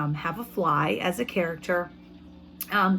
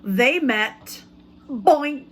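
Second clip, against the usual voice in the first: louder, higher read with a punchy Boink; storybook reading, loud Boink; 4 semitones higher, 6 dB louder, much slower than usual. A narrator's reading. Teacher reading to first graders, playful loud sound word. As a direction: make the bump land with a bang. Reads story aloud to class.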